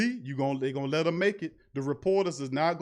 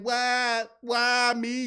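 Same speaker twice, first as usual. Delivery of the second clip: mock crying plea; 7 semitones higher, much slower than usual. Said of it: "You crying and begging".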